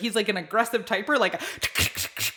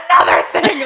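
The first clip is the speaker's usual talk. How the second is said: louder, higher mock rant with a laugh; 8 semitones higher, 9 dB louder than usual. Caller winding up at the agent, laughing mock rant. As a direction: launch the next complaint, hammed-up.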